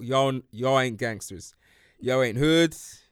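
slow, each word stressed; emphatic repeat. Shown as a demonstration. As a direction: spell it out slowly. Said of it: "I'm gonna say that again".

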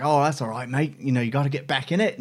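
Australian imitation slipping into British; botched Australian accent. Rep consoling him after the failed swim, botched Australian-British accent. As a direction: try Australian, land on British. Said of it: "that's British".

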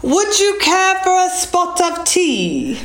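high, slow put-on British accent. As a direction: stretch out a mock-British tea invitation. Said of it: "how to say in a British accent".

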